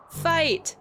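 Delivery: high, loud shout; battle cry. Acted. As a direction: yell it to start the fight. Battle cry starts fight.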